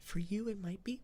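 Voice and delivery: ordinary talk, nothing marked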